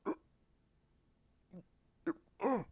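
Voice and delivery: short acted grunts; imitated grunts. Villain suddenly stopped by the Force, strained grunts. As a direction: grunt twice as if struggling. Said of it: "He's going".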